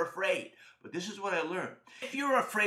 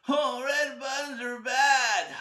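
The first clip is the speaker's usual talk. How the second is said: slow, higher, drawn-out wail; fearful wail; 6 semitones higher, 3 dB louder, slower than usual. Acted. Boy tells parents after nightmare, fearful wail. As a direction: wail it like a spooked child.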